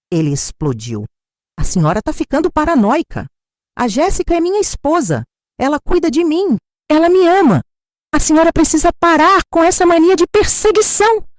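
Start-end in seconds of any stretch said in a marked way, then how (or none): 1.6-11.3 filho gritando bem mais alto e agudo; grito furioso. A narrator's reading. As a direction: explode com raiva contra a mãe. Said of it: "meu filho gritou comigo". (filho gritando com a mãe, furioso)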